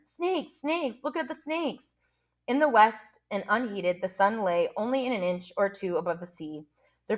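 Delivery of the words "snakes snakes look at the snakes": retold scream. A narrator's reading. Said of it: "The little boy screamed at them".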